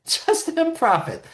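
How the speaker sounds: a giggle breaking into Just improv it; amused retelling of Mickey's advice; laughing. Retold or imitated. Laugh heard while quoting Mickey.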